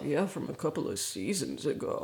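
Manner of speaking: low, soft grumble under the breath; husband's sulky grumble about the dress; grumbled aside. Retold or imitated. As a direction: mutter it to yourself, annoyed. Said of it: "he grumbles to himself".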